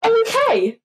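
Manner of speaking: high, loud, laughing disbelief; incredulous okay. Retold or imitated. Teen stunned by mum's calm reply, squeaking in disbelief. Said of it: "I went".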